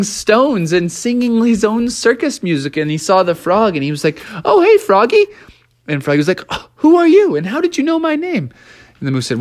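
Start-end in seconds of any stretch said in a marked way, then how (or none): 4.4-5.3 high, bright cartoon greeting; a silly high voice saying hi; friendly hello from a goofy moose; cheerful moose greeting. Moose greets frog in story. 6.5-8.5 high squeaky frog voice, quick at the end; a startled tiny frog asking who's there; a little frog, high and puzzled, to the moose; surprised little frog. Acted. Froggy answers the moose.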